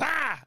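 sudden high "Ah!"; imitated startling yell. Kid jumps out at his dad, imitated high-pitched yell. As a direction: mimic a jump-out scare cry.

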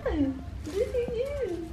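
very slow, high and soft coo. Real, not acted. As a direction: melt over the puppy, stretching it out. Admiring the puppy.

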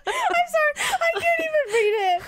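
high, loud-ish laughing squeal; embarrassed laughing. Real, not acted. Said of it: "so embarrassed to even".

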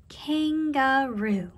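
"Kangaroo" slow and even, kan-ga-roo; syllable by syllable. Shown as a demonstration. Teacher repeats for careful listening, "Kangaroo" drawn out by syllable. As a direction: sound out "Kangaroo" in three long beats. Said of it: "Let's listen carefully".

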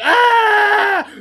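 high, loud yelp of panic; a loud retold "ah" of alarm; retold panicked scream. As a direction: let out a sudden frightened yell. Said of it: "I'm like".

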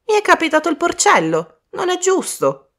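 very high-pitched protest at unfairness; complaining boy. Acted. Colin gets the pig charm, protesting. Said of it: "Bridget si mise subito a fargli il verso".

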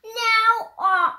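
high, lively storybook read-aloud; reading to children. Acted. A child reading a rhyming book aloud, high and animated. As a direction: bounce through the rhymes like a picture book.

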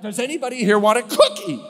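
high, loud, cheery hostess call; bright offer. Retold or imitated. Chaplain offering cookies to the tense room, loud cheery call. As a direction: brightly break the awkward silence.

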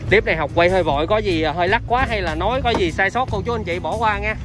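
ordinary talk

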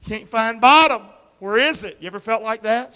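sudden loud shout in the sermon; loud preaching cry. Acted. Pastor acting out falling to his people, loud cry. Preacher acts out free fall.

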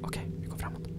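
hushed whisper. Real, not acted. Sneaking forward in the dark, whisper. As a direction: whisper as you move on.

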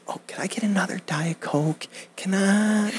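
whispered, timid little request for a Diet Coke; meek apologetic whisper. Shown as a demonstration. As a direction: shrink down and ask like you're intruding. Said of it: "maybe you do this".

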